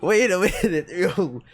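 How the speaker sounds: half laughing, catching up to the twist; laughing disbelief. Real, not acted. Player still laughing at the villain reveal, chuckling. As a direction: still chuckling through wait a minute.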